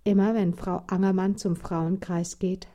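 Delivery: ordinary delivery, nothing marked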